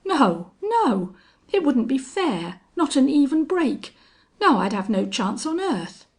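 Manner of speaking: high, loud, frantic objection; rattled and pleading loudly; panicked protest. A narrator's reading. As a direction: protest in alarm, pitch pushed up. Gunman challenged him, he protests.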